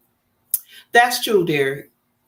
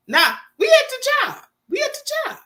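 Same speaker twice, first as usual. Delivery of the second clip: incredulous exclaim. Real, not acted. Exclaiming story detail.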